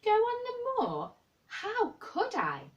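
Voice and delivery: high, slow startled disbelief; the idea jolts him, so rise sharply in pitch. Acted. Sick boy startled at Mary's bold idea, high and slow. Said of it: "He moved as if he were startled".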